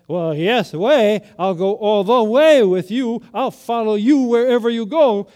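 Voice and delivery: Peter's loud, eager promise to follow; eager vow. Retold or imitated. Peter pledging loyalty to Jesus, loud and eager.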